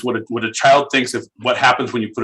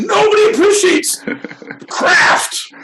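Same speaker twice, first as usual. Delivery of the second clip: high, slow, fake-crying wail; mock Sally Field sob; 4 dB louder, slower than usual. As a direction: ham up a tearful awards plea. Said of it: "I'm going Sally Field on this bitch".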